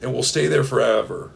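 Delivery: ordinary talk, nothing marked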